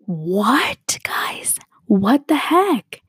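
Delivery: breathy gasped what, then drawn-out rising exclamation; stunned disbelief. Real, not acted. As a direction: let the shock stretch the words out. Reacting to the killing story.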